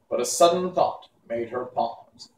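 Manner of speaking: usual speaking voice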